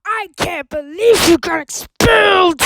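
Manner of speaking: high, loud scolding parent; a new character storming in, outraged; angry parent voice. Acted. Parent yelling at the kid about being expelled.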